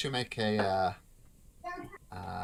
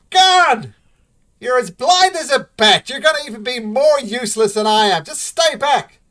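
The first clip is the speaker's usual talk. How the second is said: loud, much higher angry dwarf woman; shouting back; 14 semitones higher, 15 dB louder than usual. Acted. Sister shouting back at her brother, angry and loud. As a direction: snap back at him across the room. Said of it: "And she says".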